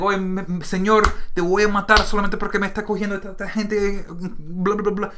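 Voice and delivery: high, fast Spanish rant; fast, high Spanish rant trailing into blah blah; Spanish-speaking migrant. Retold or imitated. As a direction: rattle it off in Spanish, fed up. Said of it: "the guy could be like".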